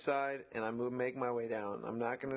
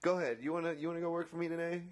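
sarcastic; 6 semitones higher, faster than usual. Pretend. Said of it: "I just wanna be like".

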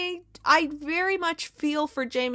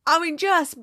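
ordinary delivery

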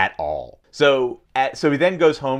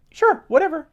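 high, offhand brush-off; dismissive; 3 dB louder than usual. Retold or imitated. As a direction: shrug it off, uninterested. Bored reply to date offer.

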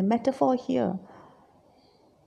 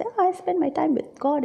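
higher-pitched, pleased-with-oneself air; mock holier-than-thou; self-righteous; 7 semitones higher than usual. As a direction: mimic pious self-congratulation. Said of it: "feeling so self-righteous".

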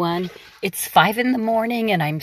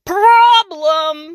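high, louder witch screech; 10 semitones higher, 5 dB louder than usual. Acted. The witch finding no piggies, screeching. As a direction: screech the bad news. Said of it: "screeched Gritch".

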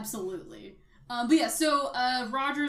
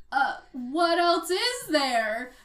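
slow, louder rising question; clueless himbo; 4 semitones higher, 5 dB louder, much slower than usual. Retold or imitated. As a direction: drag it out like a baffled himbo. Same line, acted version.

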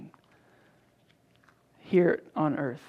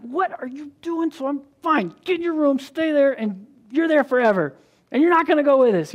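higher, louder angry parent scolding; mad dad; 7 semitones higher, 5 dB louder than usual. Retold or imitated. Father sending his defiant daughter to her room, angry and loud. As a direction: blow up at the kid and send her off. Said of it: "I start getting really mad".

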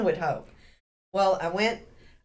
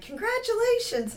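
cheery congratulations. Retold or imitated. Test staff congratulating her.

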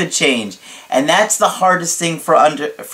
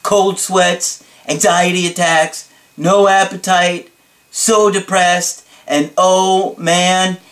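higher, slow, held-out list; dramatic client imitation; 4 semitones higher, slower than usual. Client listing breakup misery on Skype, slow and dramatic. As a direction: stretch each symptom out for drama. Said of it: "this is the way he said it to me".

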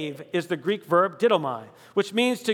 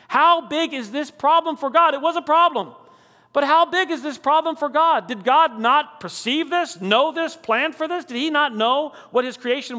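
preaching at full force; 5 semitones higher than usual. Acted. Emphatic rhetorical questions in sermon.

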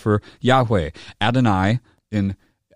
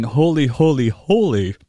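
a raised, louder triple holy; declaring his holiness; emphatic holy, holy, holy; 5 semitones higher, 4 dB louder than usual. Shown as a demonstration. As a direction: ring out each holy. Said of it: "they're saying that he is the embodiment of holy".

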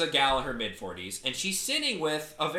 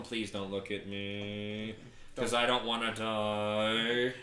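slow, drawn-out, lowered plea; retold pleading; 5 semitones lower, 4 dB quieter, much slower than usual. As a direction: plead slowly under your breath, mock-fearful. Frightened teller silently pleads to himself.

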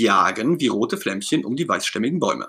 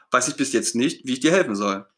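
ordinary delivery, nothing marked

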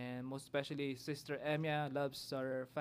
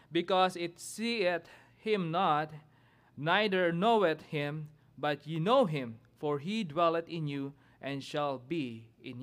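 scripture reading; 4 semitones higher, 3 dB louder than usual. Acted. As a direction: proclaim the verses from the pulpit. Reads scripture aloud, responsive reading.

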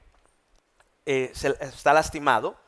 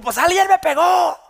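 much higher, loud protest; insistent shout; 12 dB louder than usual. Retold or imitated. Pastor shouts at the referee that somebody hit him, high and loud. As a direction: yell it like you're sure.

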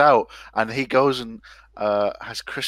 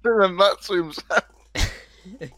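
slow, high, breaking up with laughter; real laughter stretching the words; laughing through it; 7 semitones higher, much slower than usual. Real, not acted. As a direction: struggle to get the words out through giggles. Group cracking up over escalating joke.

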